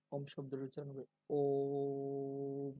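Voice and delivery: ওম said slowly, held long and full; drawn-out Om. Shown as a demonstration. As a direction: stretch ওম out, take your time. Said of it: "একটু সময় নিয়ে". (ধীরে টেনে ওম উচ্চারণ)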